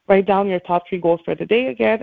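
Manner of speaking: ordinary delivery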